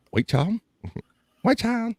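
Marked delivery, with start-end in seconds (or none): 1.4-1.9 high-pitched echo. Pretend. Repeats the phrase far higher.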